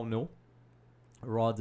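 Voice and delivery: ordinary talk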